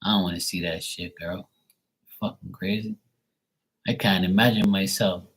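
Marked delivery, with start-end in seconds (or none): none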